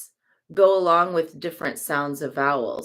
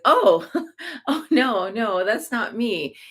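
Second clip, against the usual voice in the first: higher, laughing denial; embarrassed laugh; 5 semitones higher than usual. Retold or imitated. Mistaken for someone else, laughing denial. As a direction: laugh it off, caught off guard. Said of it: "that little, like, laughter".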